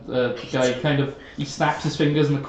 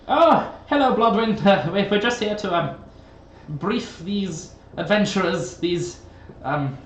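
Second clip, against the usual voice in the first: higher, flustered little gnome; hesitant, raised gnomish voice; halfling gnomish man; 5 semitones higher than usual. Acted. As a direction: bumble through a greeting as a small halfling. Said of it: "halfling, gnomish man".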